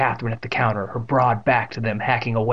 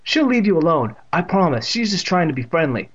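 ordinary delivery, nothing marked

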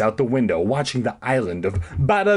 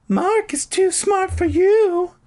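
very high, slow put-on woman voice; squeaky high character voice; Carolyn voice; 4 dB louder, slower than usual. A narrator's reading. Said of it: "she told him".

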